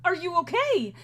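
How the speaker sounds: high, urgent worried exclamation; alarmed concern. Acted. Lyrinus rushing to fallen Ariadne, alarmed concern. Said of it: "immediately rushes over and is like".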